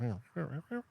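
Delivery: cat answering back in meows; imitated cat meowing. Man plays his cat talking back, mock meows. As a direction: meow back in short bursts. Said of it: "And he goes".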